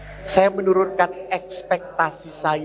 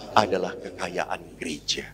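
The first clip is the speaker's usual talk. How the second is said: softly whispered, reverent; preacher's reverent whisper; hushed whisper; 7 semitones lower, 5 dB quieter than usual. Acted. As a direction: lean in and whisper it.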